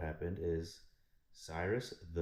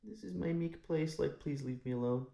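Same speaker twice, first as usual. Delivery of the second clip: meek plea; 9 semitones higher than usual. Retold or imitated. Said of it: "his tomb was kinda like".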